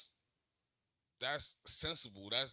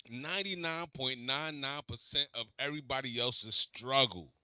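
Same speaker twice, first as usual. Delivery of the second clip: normal talking voice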